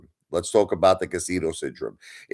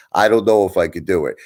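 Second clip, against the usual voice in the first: normal talking voice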